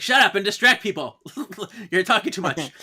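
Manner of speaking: high, loud mock telling-off; loud snap. Spirit ordering the chatty Henry to hush, loud mock snap. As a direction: bark it at him, half laughing.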